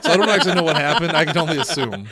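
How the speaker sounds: fast, laughing words. Real, not acted. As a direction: laugh while talking. Laughing at movie scene.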